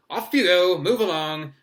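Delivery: loud, high call to a crowd; hearty projected dismissal. A narrator's reading. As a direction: shoo everyone off briskly from afar. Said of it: "Lockhart called to the crowd".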